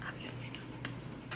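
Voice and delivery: slow, barely audible whisper; tiny voiceless aside, drawn out. Real, not acted. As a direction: whisper it drily under your breath. Wry whispered aside to viewers.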